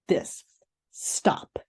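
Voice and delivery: a breathy whispered stop. Shown as a demonstration. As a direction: whisper the word stop to yourself. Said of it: "S T O P".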